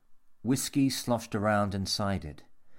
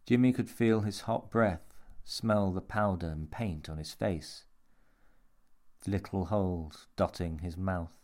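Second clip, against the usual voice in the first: ordinary delivery, nothing marked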